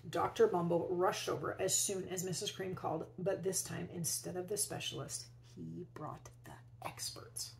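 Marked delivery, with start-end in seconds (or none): 5.5-7.5 whisper. A narrator's reading. Hushed reveal of the experts.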